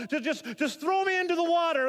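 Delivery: "throw" pushed out high and loud in panic; stammer, then beg them to throw him in; frantic plea. Acted. Jonah begging the sailors to throw him overboard, frantic. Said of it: "Jonah's terrified".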